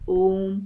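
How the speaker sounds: ओम held long and slow; prolonged om. Shown as a demonstration. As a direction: stretch out ओम as in chanting. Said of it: "थोड़ा देर तक बोलते हैं".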